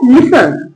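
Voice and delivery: लिसन with S running straight into N; falling model लिसन, T left out; silent T in listen. Shown as a demonstration. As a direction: say लिसन with no T sound. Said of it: "टी का sound नहीं आएगा".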